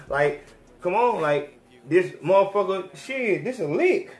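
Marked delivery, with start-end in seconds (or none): none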